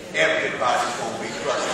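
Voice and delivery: low, grudging grumble; acted frustration. Worker back at the old job, acting frustrated. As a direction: sink into a low, sour resolve. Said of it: "if I'm gonna be frustrated".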